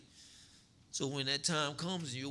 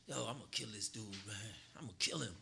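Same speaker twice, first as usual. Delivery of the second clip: inner muttering; 7 semitones lower, 8 dB quieter, faster than usual. Retold or imitated. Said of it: "saying this in my mind".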